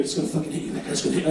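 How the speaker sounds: urgent unvoiced whisper; a friend whispering a warning. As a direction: warn your friend in a hushed whisper.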